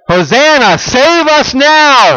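high, loud, drawn-out cry of hosanna; crowd's cry. Retold or imitated. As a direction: call it out like the crowd on the road. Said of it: "they're all crying out what".

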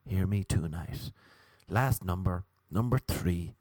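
ordinary talk, nothing marked

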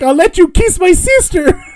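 high, loud, hammed-up plea; desperate mock yell. Man begging into Rango's bottle, mock desperate yell. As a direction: cry out a frantic bargain. Character pleading to get in bottle.

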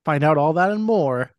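dramatic trailer narrator voice; movie trailer announcer. Acted. Reads plot as announcer.

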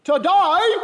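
high, loud, mock Cockney boy; a kid's terrible Cockney accent, squeaked up high; a hammed-up Cockney urchin's "Today?"; bad child's Cockney accent. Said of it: "in a terrible nine-year-old Cockney accent".

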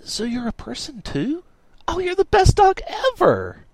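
put-on very high, gushing girl voice; high excited squeal; impressed and delighted. A narrator's reading. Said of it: "Miranda was so impressed".

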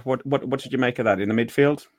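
ordinary talk, nothing marked

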